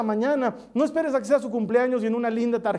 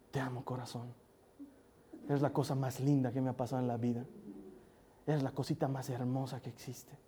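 put-on lowered, softer, loving words to a child; tender and soft; 8 semitones lower, 10 dB quieter than usual. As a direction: say it like a gentle secret to your daughter. Father tells daughter he loves her. (con ternura, suave y bajito)

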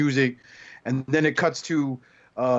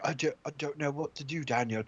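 a hint of a muddled Australian-British accent, softer; soft, confused robot in a shaky Australian-British accent; mock Aussie-British accent; 4 dB quieter than usual. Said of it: "not sure if I'm really Australian or I'm British".

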